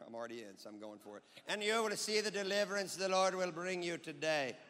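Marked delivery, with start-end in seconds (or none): 1.5-4.6 put-on Indian accent as prophet; Indian-accented Moses. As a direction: declaim it with a mock Indian lilt. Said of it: "Apparently Moses was Indian".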